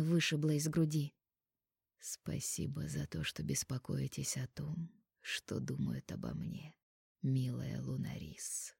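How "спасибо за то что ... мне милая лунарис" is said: slow, low, velvety whisper. Acted. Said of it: "бархатным голосом проговорил он".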